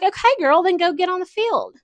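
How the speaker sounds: high, loud, fast sass; sassy call-out. Acted. Calling out her past self on the sidelines, loud and sassy. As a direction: clap back at her with attitude, fast.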